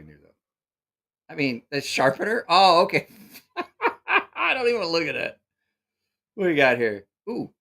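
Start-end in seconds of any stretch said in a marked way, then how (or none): 3.6-4.3 sudden squeaky laugh; high burst of laughter. Real, not acted. Host laughs at junk in the catalog, a high burst.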